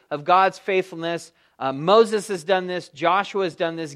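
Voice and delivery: normal talking voice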